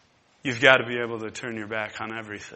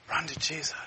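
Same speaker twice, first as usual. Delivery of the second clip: soft urging; 2 semitones higher, 6 dB quieter, faster than usual. Real, not acted.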